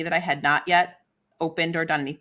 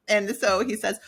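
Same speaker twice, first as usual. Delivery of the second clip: ordinary delivery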